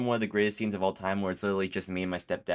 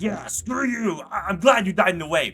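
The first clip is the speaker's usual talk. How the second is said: much higher, louder villain voice sneering at the hero; gloating taunt; 10 semitones higher, 7 dB louder than usual. Retold or imitated. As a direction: spit it out like a smug bully. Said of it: "Tank is just like".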